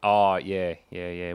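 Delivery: usual speaking voice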